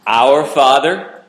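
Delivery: slow, solemn Lord's Prayer recitation; recited prayer. Acted. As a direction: lead the room through the prayer, measured and reverent. Congregation prayer recited aloud.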